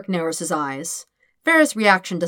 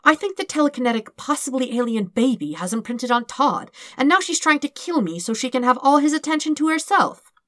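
frantic theory; 7 semitones higher than usual. Acted. Dirk's frightened conclusion rushing.

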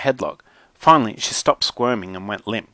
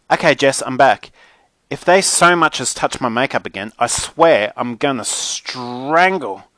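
put-on high, loud indignant girl on the phone; exasperated Mackenzie, dramatic diva; 5 semitones higher, 5 dB louder than usual. A narrator's reading. Mackenzie gripes to Jess about kids in her room, exasperated. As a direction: complain in outrage, calling out for Mum.

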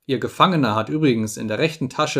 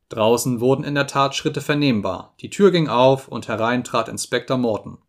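usual speaking voice

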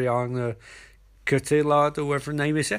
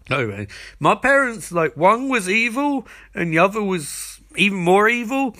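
higher, worked-up imitated ranting; exasperated mock rant; 6 semitones higher, 4 dB louder than usual. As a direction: pile up grievances, voice climbing. Acted backstory rant piling up.

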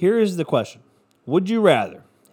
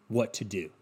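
usual speaking voice

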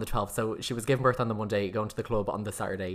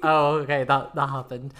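mock shock; 4 semitones higher, 9 dB louder than usual. Mimicking her own shocked reply.

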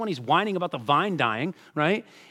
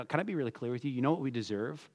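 ordinary talk

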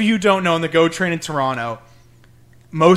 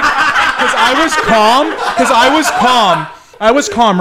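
laughing; 5 semitones higher, 7 dB louder, slower than usual. Real, not acted. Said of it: "repeats calm, laughing".

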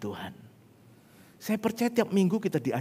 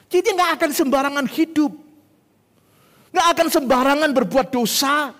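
loud, high, emphatic sermon delivery; full-force preaching; 11 semitones higher, 9 dB louder than usual. Real, not acted. Preacher warning believers against careless sin, loud and high.

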